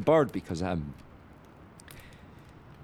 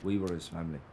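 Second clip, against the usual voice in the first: bitter low mutter; 7 semitones lower, 15 dB quieter, faster than usual. Acted. Bitter brother after fight.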